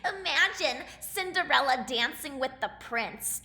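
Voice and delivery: mild mocking stepsister; mocking cackle. A narrator's reading. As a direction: jeer at the idea. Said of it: "Drizella cackled".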